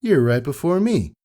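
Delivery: high, bright-eyed filly voice; Pyra's voice. Acted. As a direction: pitch way up to play the cheeky pony. Pyra voiced in read story.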